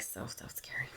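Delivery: breathy whisper of fear. Real, not acted. Mother whispering how frightening the hospital was. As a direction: drop to a whisper, still scared. Mother retelling daughter's illness.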